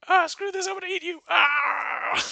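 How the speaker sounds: high, loud fed-up wolf yell; wolf pounces. Acted. Wolf giving up the disguise and attacking Red, high loud yell. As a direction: drop the act and lunge with a yell.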